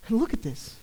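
usual speaking voice

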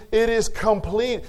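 a pastor thundering that grace is enough, full-force preaching; climactic preaching. Acted. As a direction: build the sermon to its peak.

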